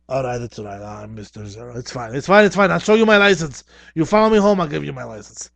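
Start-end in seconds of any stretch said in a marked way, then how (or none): 2.1-5.5 nervous bargaining. Acted. Bargaining with clown police.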